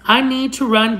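normal talking voice

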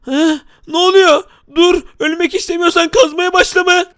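high-pitched alarmed cry; startled mock panic. Gamer is surprised while digging, high startled voice.

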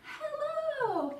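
animated, sing-song picture-book reading; playful storytime narration with stretched key words; reading to children. Acted. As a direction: read it to toddlers with lively, swooping pitch.

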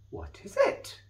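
whispered high wondering question. Acted. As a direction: breathe it out curiously. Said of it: "wondered Mister Boo".

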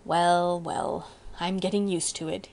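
mildly sheepish, drawn-out admission; sheepish concession. A narrator's reading. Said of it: "relaxed into a sheepish smile".

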